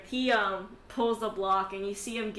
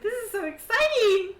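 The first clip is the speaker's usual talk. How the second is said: very high, loud excitement; high-pitched excited outburst; excited squeal; 13 semitones higher, 11 dB louder than usual. Acted. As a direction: squeal it like a thrilled spectator. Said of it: "She's like".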